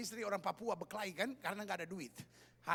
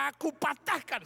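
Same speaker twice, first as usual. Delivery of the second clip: loud, high commanding shout; acted deliverance-preacher shout; exorcist command; 6 semitones higher, 7 dB louder than usual. Acts out exorcist commanding Satan.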